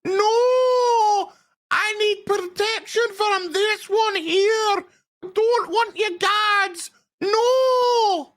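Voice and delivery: high, whiny pleading queen voice; hammed-up simpering queen; mock damsel queen. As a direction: play a fake helpless queen begging. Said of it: "trying so hard to be like".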